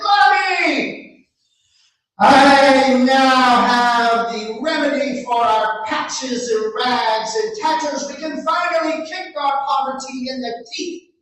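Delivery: a naive lad handing over his treasure; Antonio's voice. Acted. Antonio voiced, high and loud.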